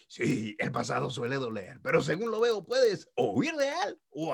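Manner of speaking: put-on raspy old mandrill voice with a Cuban lilt; raspy Rafiki voice. Rafiki teaching about the past, raspy Cuban-tinged voice. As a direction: wise raspy baboon with a Caribbean accent. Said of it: "con esa voz medio rasposa de".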